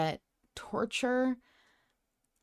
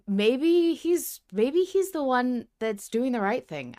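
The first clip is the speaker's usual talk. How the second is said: high, louder playful voice; mock dramatic musing; 8 semitones higher, 6 dB louder than usual. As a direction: toy with the idea in a hammed-up high voice. Mock villain-sympathy musing.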